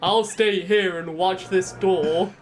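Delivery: slow, stilted game voice actor; deliberate, hammy instructions, spaced out; Barry from Resident Evil. Acted. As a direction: wooden video-game hero voice. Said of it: "that sounds like Barry".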